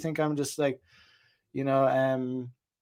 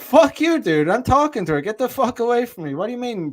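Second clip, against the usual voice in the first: angry shout; 7 semitones higher, 4 dB louder than usual. Pretend. Imagined blow-up at cock-blocking friend.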